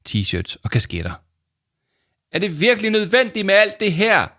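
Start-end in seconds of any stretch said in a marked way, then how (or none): 2.3-4.3 high, loud call across the noise; raised voice over a throng; shouting over the crowd. Acted. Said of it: "råbte Arthur til Jama".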